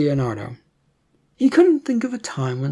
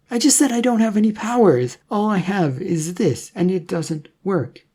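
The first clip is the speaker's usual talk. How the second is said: higher, louder Leonardo, fed up; exasperated young man; 6 semitones higher, 4 dB louder than usual. Acted. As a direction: insist you have no powers.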